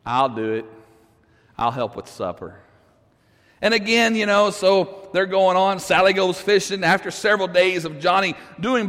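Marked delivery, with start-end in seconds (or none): 0.1-2.6 put-on resigned mumble of a trapped boy; defeated kid.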